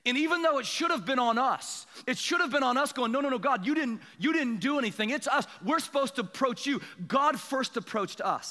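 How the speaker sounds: high-pitched, driving sermon cadence; impassioned preaching. Real, not acted. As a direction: preach it in repeated, building phrases. Preacher presses the point.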